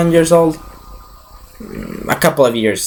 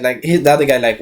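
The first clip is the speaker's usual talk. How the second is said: ordinary delivery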